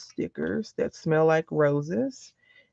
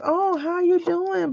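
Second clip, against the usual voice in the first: much higher, slow, syrupy politeness; a sugary mock greeting; high drawn-out mock friendly hello; high, slow sweet greeting; 12 semitones higher, slower than usual. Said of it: "They're like".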